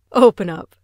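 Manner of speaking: loud yell through the door; laughing prankster shouting to be let in; loud call. Acted. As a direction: bang to be let in.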